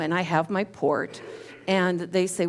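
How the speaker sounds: normal talking voice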